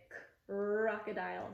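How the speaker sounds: lone "k", pause, then long-R "crocodile" minus its k; segmented blend. Shown as a demonstration. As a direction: split "k, crocodile" after the k. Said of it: "segment the first sound and the second sound".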